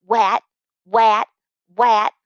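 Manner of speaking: put-on loud little-kid chant of wat; toddler mocking. Baby brother teasing his big brother, loud toddler chant. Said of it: "baring his little fangs and going".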